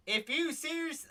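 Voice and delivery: southern accent, abandoned mid-word; attempted southern accent. Shown as a demonstration. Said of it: "do this in a southern accent".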